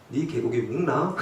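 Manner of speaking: startled Gyeongsang dialect blurt, laughing; surprised man slipping into Gyeongsang dialect. Retold or imitated. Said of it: "사투리가 튀어나온 거예요".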